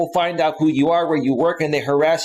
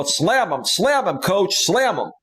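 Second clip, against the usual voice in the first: imitated Southern twang, swooping higher; deep South accent; 5 semitones higher, slower than usual. Host imitating a panel guest cheering on bans, deep South accent. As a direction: mimic an egging-on deep South regular. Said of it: "he sounds deep South".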